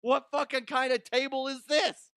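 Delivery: high, loud baffled question; stunned disbelief at the news story; incredulous. Real, not acted. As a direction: demand to know, outraged.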